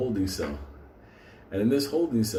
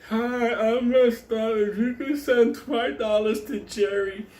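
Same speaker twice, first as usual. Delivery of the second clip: much higher, slow, put-on charity-kid voice; mocking telethon kid; 11 semitones higher, slower than usual. Mocking a telethon kid asking for money, slow high put-on voice. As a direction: drag it out like a telethon plea.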